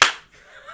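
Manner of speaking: showy burst of laughter; big stagey chuckle; gleeful laugh. Pretend. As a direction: laugh with glee at the result. Gleeful reaction to the rolled drink.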